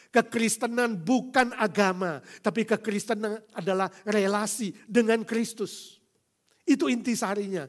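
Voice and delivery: loud, high preaching climax; rising fiery sermon; preaching at full force. Acted. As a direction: thunder it from the pulpit.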